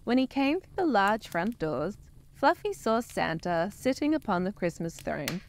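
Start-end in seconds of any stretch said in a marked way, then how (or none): none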